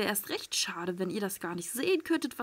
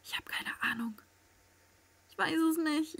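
soft whispered confusion; 6 semitones higher, 5 dB quieter than usual. Real, not acted. Hiding player whispering to viewers. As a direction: whisper it, lost and tense.

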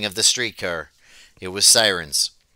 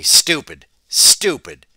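grim scorn; 2 semitones lower, 4 dB louder, slower than usual. A narrator's reading. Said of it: "shook his head grimly".

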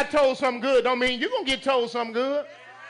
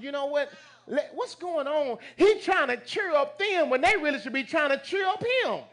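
normal talking voice

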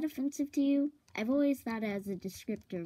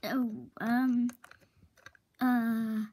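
usual speaking voice

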